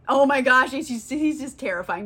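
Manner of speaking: normal talking voice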